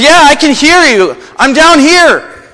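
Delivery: high, loud desperate call upward; man hanging from a cliff. Retold or imitated. Joe calling up from the branch, high and strained. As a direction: shout up from the cliff. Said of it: "He says".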